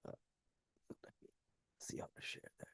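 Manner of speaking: muttered under his breath; whispered to self. Real, not acted. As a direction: whisper it to yourself while busy. Muttering while sharing his screen.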